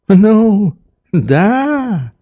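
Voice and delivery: put-on high, hesitant old man's voice; smiling old doctor. A narrator's reading. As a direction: agree with an involuntary smile. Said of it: "улыбаясь невольно этому новому капризу".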